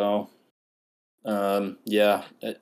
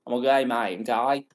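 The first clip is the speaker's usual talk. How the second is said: mock Aussie lilt, rising at the end; Australian accent; 4 semitones higher than usual. Host correcting co-host's attempt, put-on Australian accent. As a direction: do a hammed-up Australian twang. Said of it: "do an Australian accent".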